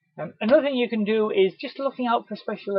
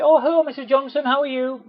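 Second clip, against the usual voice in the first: higher, louder bright greeting; cheery hello; 8 semitones higher, 6 dB louder than usual. Pretend. Delivery man hailing a customer in the street, cheery. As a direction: call out warmly with a wave. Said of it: "quick cheery wave and say".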